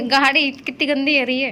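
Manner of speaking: laughing through the words. Real, not acted. Wife laughing at the muddy car, giggling. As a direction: giggle while you say it.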